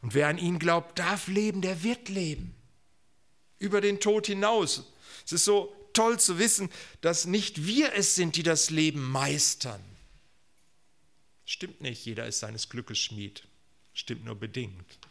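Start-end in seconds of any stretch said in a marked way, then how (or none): 0.0-9.8 raised, emphatic pulpit voice; exultant preaching. Real, not acted. Preacher promises life beyond death, exultantly. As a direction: proclaim it with rising fervour.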